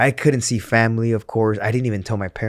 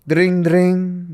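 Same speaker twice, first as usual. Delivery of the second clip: high, louder mock ringtone; imitated phone ring; 8 semitones higher, 4 dB louder than usual. Storyteller mimicking his phone ringing, playful ring.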